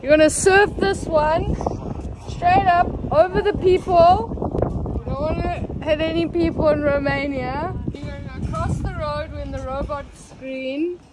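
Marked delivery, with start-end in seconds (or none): 0.0-7.7 projecting loudly; louder. Real, not acted. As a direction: speak up, louder. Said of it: "Just talk a bit louder".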